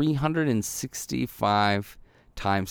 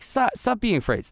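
nagging inner voice; 11 semitones higher than usual. Retold or imitated. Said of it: "this voice in my head".

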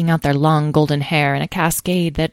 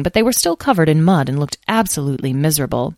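plain reading voice

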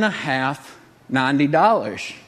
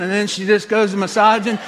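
usual speaking voice

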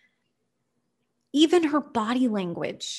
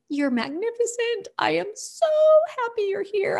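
much higher, besotted puppy voice; eager dog voice; 12 semitones higher than usual. Retold or imitated. Trooper the dog telling his owner he adores them, high and gushing. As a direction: gush like a dog adoring its owner. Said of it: "he just has to tell you".

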